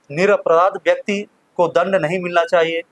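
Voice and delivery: ordinary talk, nothing marked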